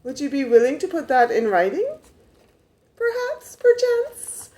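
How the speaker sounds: a mock-genteel repeat, rising high on perchance; mock posh. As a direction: ask it with exaggerated fake politeness. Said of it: "I didn't say it that way".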